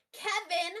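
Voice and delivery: loud, drawn-out accusing yell; aggrieved holler. Acted. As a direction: yell the name across a room. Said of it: "she turns around and yells".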